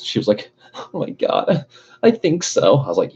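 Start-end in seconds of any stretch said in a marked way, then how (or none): 0.7-2.8 high, breathy mock of the other driver; mocking ditzy girl voice. Mocking the driver who hit his car, high girly voice. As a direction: whine it like a clueless girl.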